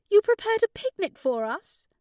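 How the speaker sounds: high, loud astonished question; very high-pitched delighted disbelief; exclaiming. A narrator's reading. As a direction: exclaim it, touched and amazed. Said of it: "Claire exclaimed before starting to cry".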